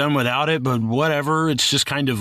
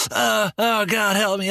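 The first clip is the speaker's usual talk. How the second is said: whiny helpless victim; 8 semitones higher, slower than usual. Retold or imitated. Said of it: "He wasn't like".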